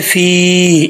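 "फ़ी" with the ee held long; lengthened vowel. Shown as a demonstration. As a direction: stretch the vowel of "फ़ी" slowly. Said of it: "इसको लंबा करेंगे". ("फ़ी" को लंबा खींचकर पढ़ें)

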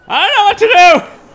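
loud high exasperated yell; frustrated shout. Real, not acted. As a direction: cry out in frustration. Frustrated with controls.

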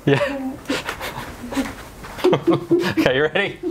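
speaking inside a snorkel mask; laughing through a full-face mask; muffled through a face mask. Real, not acted. Seen 3: Woman wears full-face snorkel mask throughout while laughing, bent forward.

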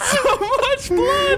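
laughing. Real, not acted. Shocked laughing at gory crash.

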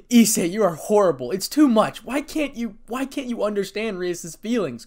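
loud, high, upset accusation; tearful reproach. A narrator's reading. Azia confronting Issei over Rias, upset and loud. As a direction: plead and scold, pitch climbing. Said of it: "questioned Azia, tears in her eyes".

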